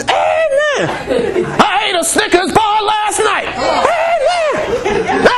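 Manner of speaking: squealed high, laughing through a mock amen; mock congregation amen. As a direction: ham up the crowd shouting amen while laughing. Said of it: "everybody would say".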